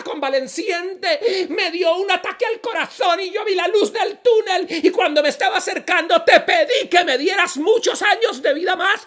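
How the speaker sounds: high, loud, shouted protest; indignant complaint. Acted. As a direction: hammer out the grievance to God at high pitch. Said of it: "le hizo el reclamo".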